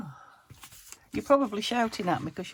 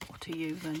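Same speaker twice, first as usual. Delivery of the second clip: ordinary delivery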